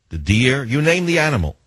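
ordinary talk

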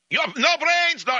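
very high-pitched mock Russian yelling; screaming crazed Russian. Crazed Russian berates the man parked against his bumper, screaming. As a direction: rant in a frantic, high imitation of an angry Russian. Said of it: "he's screaming and yelling".

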